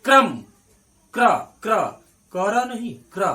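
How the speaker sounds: क्र stressed loud as a tight cluster, not कर; loud, drilled क्र sound; teacher's kr demo. Shown as a demonstration. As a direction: hammer the क्र sound for the class. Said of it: "अभी हमने क्या उच्चारण किया?".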